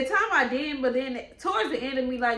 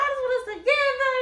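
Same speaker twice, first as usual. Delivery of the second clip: high pleading whine; hammed-up begging him back; mock pleading; 6 dB louder than usual. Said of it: "I'm like".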